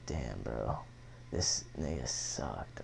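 low, soft, slow muttered dismay; quiet, slow disbelief. Retold or imitated. Teen staring in the mirror at his face tattoo, quiet dismay. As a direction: let it sink in, low and quiet.

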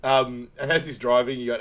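ordinary talk

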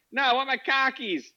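high, insistent mock Boston accent; hammed-up Boston protest; Boston accent meme. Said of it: "Yeah, it's Boston".

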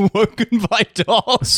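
high, drawn-out theatrical incredulity; high, slow mock wail; mock-dramatic echo. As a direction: repeat it like a tragic monologue, laughing. Mocking echo, dramatic monologue style.

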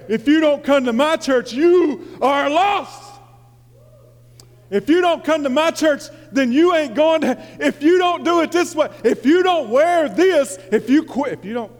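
high, loud, hammed-up preachy scolding; mock the condemning churchgoers; mock fire-and-brimstone scolding, pitched high and loud; harsh church judges. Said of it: "they sounded like they had hell".

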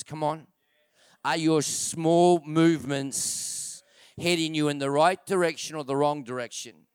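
ordinary talk

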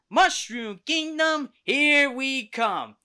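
high, loud, drawn-out heroic cry; Mario. Retold or imitated. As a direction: call it out slowly like a game hero setting off. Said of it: "we get to hear Mario's voice again".

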